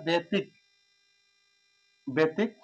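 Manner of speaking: বেতিক said on its own, with the k cut off as the end of the syllable; syllable split. Shown as a demonstration. Teacher breaking ব্যতিক্রম into syllables, quiet. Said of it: "ক একটা বের করে নিয়ে আসতে হবে".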